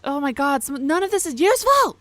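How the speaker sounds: rising, loud exasperation; exasperated outburst. Real, not acted. Player finds none of the search results useful, exasperated. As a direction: build to a high, frustrated cry.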